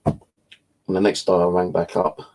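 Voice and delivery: ordinary delivery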